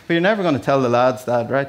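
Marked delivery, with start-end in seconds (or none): none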